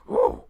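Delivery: loud, high-pitched "woo"; sudden in-character whoop. Acted. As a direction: let out a sharp high whoop. Game master's character reacts to smell.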